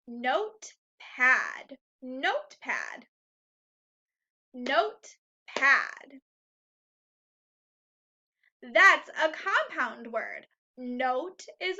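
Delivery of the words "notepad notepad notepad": Notepad drawn out slowly, each syllable clear; slow clear repeat. Shown as a demonstration. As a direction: say Notepad slowly for children to hear both parts. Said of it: "teacher repeats word slowly".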